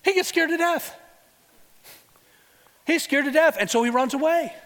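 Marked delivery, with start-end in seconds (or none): 0.0-3.6 much higher, excited delivery; preacher dramatizing Elijah's fear, high and emphatic; emphatic exclaim. Real, not acted. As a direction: paint his terror for the congregation.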